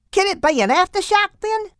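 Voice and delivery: very high, tiny insect voice; very high little mantis voice; squeaky mantis. Acted. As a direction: play Cookie wondering aloud. Cookie mantis voice.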